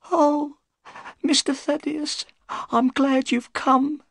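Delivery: put-on very high, trembling old woman's voice; frightened housekeeper. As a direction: plead as the terrified old servant.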